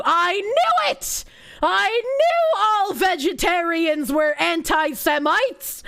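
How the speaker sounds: loud, slow, hammed-up indignation; mock outrage. Mocking a chat comment with fake outrage. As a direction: ham up a fake outraged gasp of discovery.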